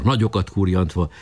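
ordinary delivery

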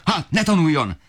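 very high, cutting angry shout; father snapping back at the mother, high furious yell; furious retort. Acted. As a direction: slam the idea down.

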